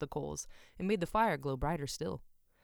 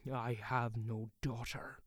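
slow, softer, lower cold denial; 5 semitones lower, 3 dB quieter, slower than usual. A narrator's reading. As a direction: shut the subject down hard. Blacksmith denies daughter.

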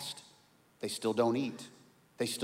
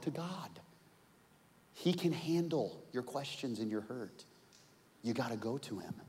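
normal talking voice

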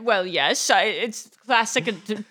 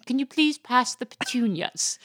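British accent demo. Shown as a demonstration. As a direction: model a lordly British accent. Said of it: "your accent is not".

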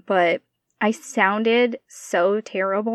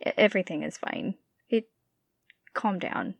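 calming self-talk; 7 dB quieter than usual. Retold or imitated. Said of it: "Is what I told myself later".

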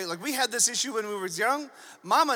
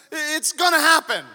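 high, loud insistence; hammed-up angry decree; Gabriel, furious; 5 semitones higher, 6 dB louder than usual. Angel insisting to doubting Zechariah, furious. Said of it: "The angel gets furious".

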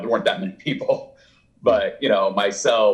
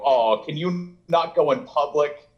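drawn-out, higher-pitched ribbing; mock teasing; 5 semitones higher than usual. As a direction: tease a famous friend with fake concern. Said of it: "kinda joked and made fun of him".

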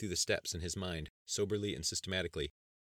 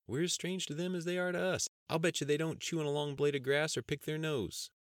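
goofy cousin Andy; 10 semitones higher, 3 dB louder than usual. Acted. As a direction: play the joking country cousin up high. Andy's character voice.

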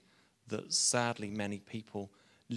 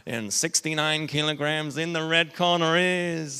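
American boxing announcer; 3 semitones higher, 7 dB louder than usual. Acted. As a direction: belt it out like an American fight MC. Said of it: "that's my best American accent".

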